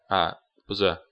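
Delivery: ordinary talk, nothing marked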